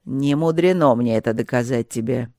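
haughty. A narrator's reading. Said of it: "Дух отвечал высокомерно".

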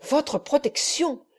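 « Votre » plus aigu, lent et sans force; il répète le mot avec dédain; mépris. A narrator's reading. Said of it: "fixant sur son visage deux yeux enflammés". (le moine répète avec mépris)

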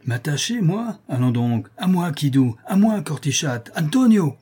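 high, indignant calls for help; crying out. A narrator's reading. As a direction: call accomplices by name, voice climbing. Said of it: "s'écria Malary". (cri d'appel, voix haute)

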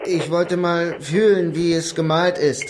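high, loud, very slow naive voice; simpleton excuse. Acted. Suspect playing dumb for the officer, slow and high. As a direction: spell it out slowly like a harmless simpleton.

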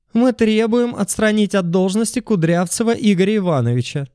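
high shrieking demand; shrieking woman. A narrator's reading. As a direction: screech it like an angry woman. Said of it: "заверещала женщина".

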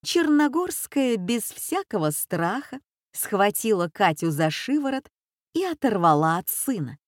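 ordinary delivery

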